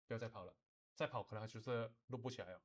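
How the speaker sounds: ordinary talk, nothing marked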